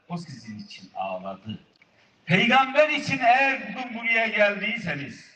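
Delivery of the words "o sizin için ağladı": hushed. Real, not acted. Sudden hush after question.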